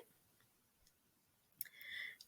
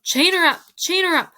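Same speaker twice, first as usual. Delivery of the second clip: high, loud, repeated command; shouted order; 8 semitones higher, 5 dB louder than usual. A narrator's reading. Riskiro demands that the princess be chained up, shouting. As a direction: call out the order urgently. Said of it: "shouted Riskiro".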